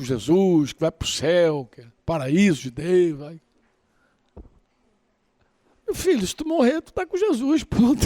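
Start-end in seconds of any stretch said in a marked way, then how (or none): 5.9-8.0 teasing reassurance. Retold or imitated. Doctor reassuring frightened missionary.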